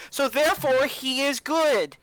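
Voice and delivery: high, slow, drawn-out conclusion; emphatic explaining. Acted. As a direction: spell out the logic slowly and firmly. Player spells out logic to sword.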